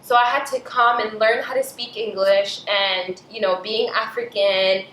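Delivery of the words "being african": ordinary talk, nothing marked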